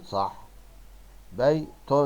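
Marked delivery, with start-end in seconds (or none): 1.3-1.9 "بيت" split as "bay... tun"; higher, louder بيت with a separate -tun; tanween damma ending. Shown as a demonstration. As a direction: sound out بيت in two parts, nunation voiced. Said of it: "وانطق التنوين بالضم".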